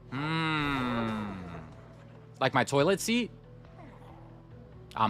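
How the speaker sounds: ordinary talk, nothing marked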